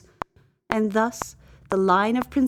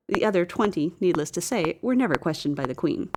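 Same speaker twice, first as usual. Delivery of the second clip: usual speaking voice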